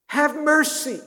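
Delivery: a desperate man begging Jesus for mercy; desperate plea. Acted. A blind beggar pleading with Jesus, desperate loud cry. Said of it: "this heart-wrenching plea".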